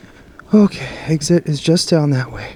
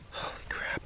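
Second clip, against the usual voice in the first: whisper; 20 dB quieter than usual. Real, not acted. Alone in spooky woods at night.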